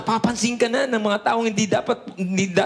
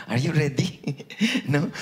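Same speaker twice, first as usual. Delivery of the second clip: laughing; 6 semitones lower, 4 dB quieter than usual. Real, not acted. Preacher laughs at congregation.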